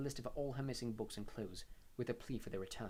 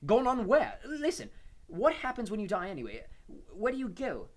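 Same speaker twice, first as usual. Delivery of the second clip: desperate; 10 semitones higher, 9 dB louder than usual. A narrator's reading. Harry desperate questions to ghost.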